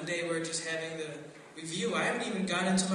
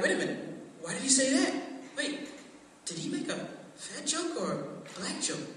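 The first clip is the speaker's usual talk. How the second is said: mildly higher, puzzled mock voice; confused classmates; 5 semitones higher than usual. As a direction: act out the confused crowd. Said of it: "they're like".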